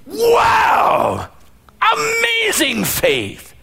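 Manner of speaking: very high, slow, delighted wow; amazed. Acted. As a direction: gasp it out in sheer wonder. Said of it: "Sometimes he's amazed".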